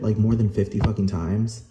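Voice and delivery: normal talking voice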